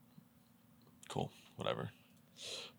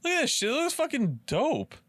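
much higher and louder, thrilled; real excitement; 4 dB louder than usual. Real, not acted. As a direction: yelp in delight at a bargain. Finds a cheap Dubai rental.

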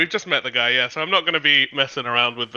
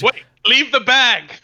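calling out; 9 semitones higher, 6 dB louder, slower than usual. Acted. Player calling after the character.

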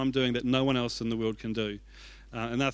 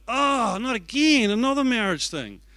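exasperated groan; 8 semitones higher than usual. Retold or imitated. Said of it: "I was like".

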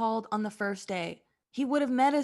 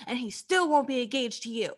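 ordinary talk

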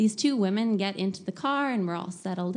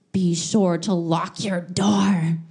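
a slow, louder, mock-ominous conductor; ominous conductor warning; 2 semitones lower, 6 dB louder, slower than usual. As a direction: drag out the warning like a spooky official.

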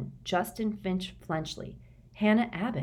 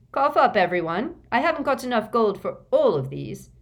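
loud cheeky call; bossy cheerful shout; Fred voice; 6 semitones higher, 7 dB louder than usual. A narrator's reading. Fred's voice in audiobook.

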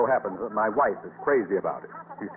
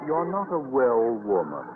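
slow worried concern; 3 semitones higher, slower than usual. Acted. Principal alarmed by Miss Brooks.

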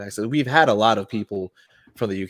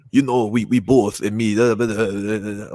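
louder mock Geordie, dissolving into babble; Geordie accent; 4 dB louder than usual. Host showing his favorite accent, garbled mock Geordie. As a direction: imitate an incomprehensible Geordie accent. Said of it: "like, the Geordie".

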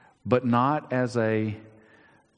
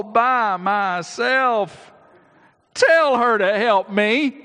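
high, loud, complaining sister; whining Martha; 12 semitones higher, 6 dB louder than usual. Retold or imitated. Martha complaining to the Lord about her sister, high whiny plea. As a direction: whine it up to the Lord, much higher. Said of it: "Now just imagine".

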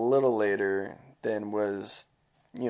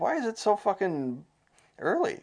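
incredulous; 9 semitones higher than usual. Retold or imitated. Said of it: "I was like".

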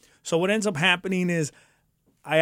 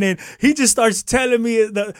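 ordinary talk, nothing marked

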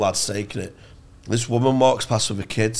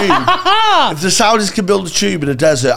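higher, louder laughing pitch; excited laughing; 8 semitones higher, 6 dB louder than usual. Real, not acted. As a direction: sell the idea, cracking up. Excited pitch for boat city.

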